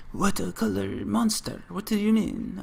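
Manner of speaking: high-pitched, badly faked French accent. French vendor questioning an American customer, bad French accent. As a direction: put on a hammy French accent. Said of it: "trying to do a French accent horribly".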